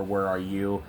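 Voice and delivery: ordinary delivery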